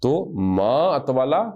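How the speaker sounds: ما اطولا very slowly, ما rising; slow model reading. Shown as a demonstration. Teacher modelling the phrase for students, very slow. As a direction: draw the phrase out for copying. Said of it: "آپ نے کہنا کتنا لمبا ہے وہ".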